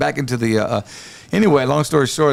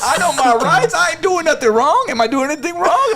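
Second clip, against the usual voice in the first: mock-outraged protest, pitched way up; indignant drunken protest. Drunk man retelling his standoff with a cop, indignant and high-pitched.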